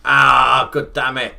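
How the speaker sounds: loud, high groan into a curse; exasperated outburst. Real, not acted. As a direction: cry out in frustration at a mishap. Game mishap happens again.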